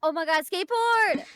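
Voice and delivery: loud, thrilled cry; excited shout. Real, not acted. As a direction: shout it out in delight. Spots a skateboard in game.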